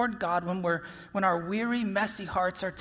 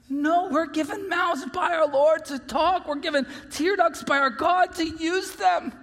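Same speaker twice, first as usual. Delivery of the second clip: raised, emphatic pulpit voice; forceful preaching; 5 semitones higher, 3 dB louder than usual. Real, not acted. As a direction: drive it home, high and punchy.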